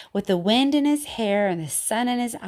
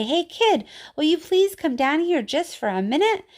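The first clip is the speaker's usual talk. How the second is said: calling up; 7 semitones higher than usual. A narrator's reading. Mayor coaxing the kid down, calling. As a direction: call him down sweetly.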